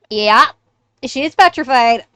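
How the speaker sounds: loud, punchy declaration; emphatic verdict. Acted. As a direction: announce it loudly after checking. Character declares her mother is petrified.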